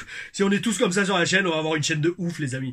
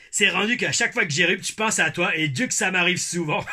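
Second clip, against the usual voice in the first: put-on Québécois accent; 2 semitones higher, 3 dB louder than usual. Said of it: "mon accent québécois".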